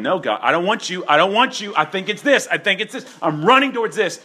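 high, loud mock protest of a stubborn person; defiant rebel. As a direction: whine it like a rebel refusing God. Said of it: "It's you going".

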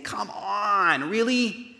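high-pitched, drawn-out exasperation; hurt, incredulous whine rising to a loud finish; distressed protest. Acted. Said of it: "he's distressed or he's grieved".

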